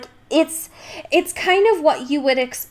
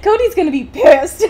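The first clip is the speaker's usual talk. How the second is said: high and loud, laughing through it; laughing aside; 7 semitones higher, 6 dB louder than usual. Retold or imitated. Host laughing about how her co-host will react. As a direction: giggle it out, higher and louder.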